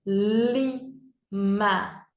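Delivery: Lima split into "li... ma"; syllable by syllable. Shown as a demonstration. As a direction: say Lima slowly in two separate beats. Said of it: "Dois pedacinhos". (Lima em sílabas: "li... ma")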